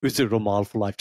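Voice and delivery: ordinary talk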